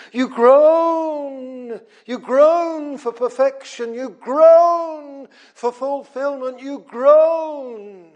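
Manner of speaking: high, drawn-out groan preaching; fervent preaching. Real, not acted. Preacher pressing the point to the church.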